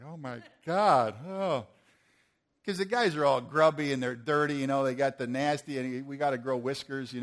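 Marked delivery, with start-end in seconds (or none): 0.0-1.7 slow, mock-horrified gasp of a reaction; imitating appalled disbelief. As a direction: act out a horrified onlooker, drawn out. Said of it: "look at me like they're horrified".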